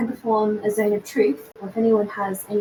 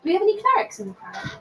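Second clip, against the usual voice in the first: calling out to the crowd; 10 semitones higher than usual. Acted. Player calls out to crowd.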